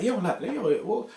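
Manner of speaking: high, incredulous yo, what's going on; angry phone call. Retold or imitated. Caller confronting the agent on the phone, high and fuming. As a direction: demand answers in disbelief. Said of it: "I'm fuming".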